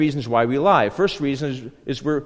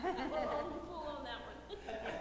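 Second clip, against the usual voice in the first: laughing; 14 semitones higher, 22 dB quieter, much slower than usual. Real, not acted. Laughing echo of audience answer.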